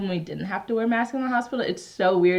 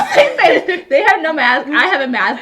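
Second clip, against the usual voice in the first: laughing; 5 semitones higher, 6 dB louder than usual. Real, not acted. Laughing about being coughed on.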